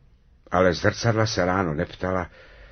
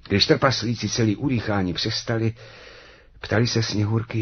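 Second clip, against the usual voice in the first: usual speaking voice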